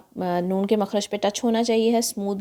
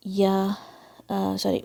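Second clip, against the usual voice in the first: normal talking voice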